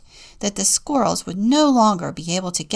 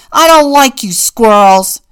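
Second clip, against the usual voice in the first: shout; 4 semitones higher, 16 dB louder than usual. A narrator's reading. Old man shouting at the squirrels to go away. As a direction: yell it out the window. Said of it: "shouted the old man".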